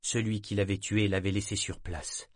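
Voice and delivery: usual speaking voice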